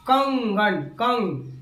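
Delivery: कंगन with the ंग pushed through the nose; nasal demo. Shown as a demonstration. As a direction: stress the nasal ंग in कंगन, then hold कंग. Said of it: "नाक पर भी बल पड़ा". (कंगन में नाक से ंग पर जोर देकर बोलें)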